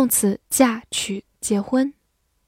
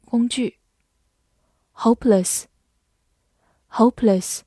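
ordinary talk

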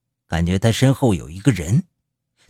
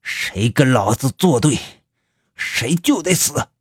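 mad roar; 2 semitones higher than usual. Acted. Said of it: "白狼发出疯狂的吼叫".

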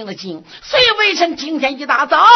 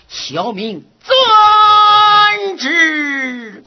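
very slow, loud, drawn-out answer; drawn out loud reply; 5 semitones higher, 4 dB louder, much slower than usual. Acted. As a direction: stretch out every syllable, calling out. Subjects answer the decree aloud.

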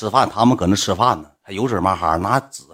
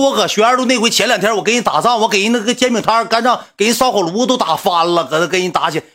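angry yelling; 10 semitones higher than usual. Retold or imitated. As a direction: shout him down with your fight story. Retold threat to fat guy.

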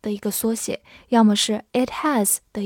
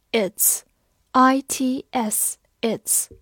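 very slow, letter by letter; slow spelling; much slower than usual. Shown as a demonstration. Teacher spelling the word slowly for students.

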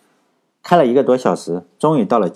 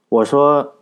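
plain reading voice

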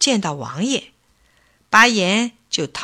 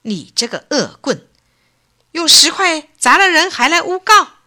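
rising to a loud, much higher condemnation; angry rebuke; 9 semitones higher, 6 dB louder than usual. Acted. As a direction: denounce the villain from the bench. Prince rebukes the slanderer.

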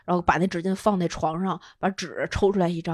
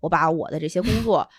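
ordinary talk, nothing marked

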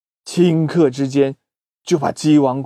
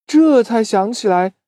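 ordinary delivery

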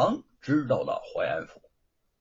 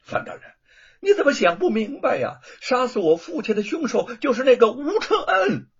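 loud, high, bitter accusation; resentful outburst; 10 semitones higher, 7 dB louder than usual. A narrator's reading. Son angrily accuses Wu Cheng'en before the prefect. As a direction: spit out the name in fury. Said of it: "愤恨不已".

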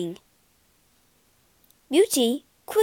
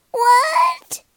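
very high, loud squeal of shock; shocked yelp; 8 dB louder than usual. A narrator's reading. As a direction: yelp in outrage. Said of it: "yelped the sisters".

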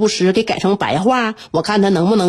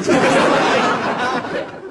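laughing; 4 semitones higher than usual. Real, not acted. Laughs at own rap.

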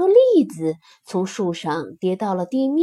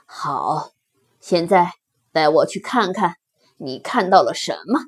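low, composed big-animal voice; lion's voice; 6 semitones lower than usual. Acted. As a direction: lower the pitch like a lion in charge. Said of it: "狮子说". (低沉的狮子声音)

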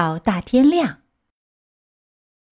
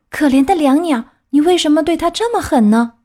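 higher, reproachful puppet voice; Pinocchio; 6 semitones higher, 3 dB louder than usual. A narrator's reading. Pinocchio scolds the cat over the bird, higher reproach. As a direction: question the cruelty, pitched up. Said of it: "皮诺乔对猫说".

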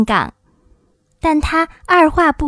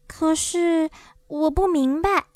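put-on slow, high, hesitant girl; high-pitched bewildered child; puzzled; 4 semitones higher, 4 dB quieter than usual. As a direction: draw it out in confusion. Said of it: "朵罗西迷惑地说".

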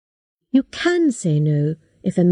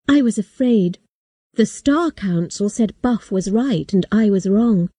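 plain reading voice

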